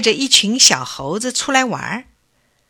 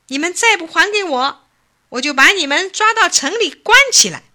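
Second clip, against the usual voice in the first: much higher, loud, stamping-mad warning; furious threat; 8 semitones higher, 3 dB louder than usual. A narrator's reading. Old man shaking his fist at the monkeys, furious threat. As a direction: threaten them at the top of his voice. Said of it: "老头儿气急了，一边晃拳头，一边跺脚说".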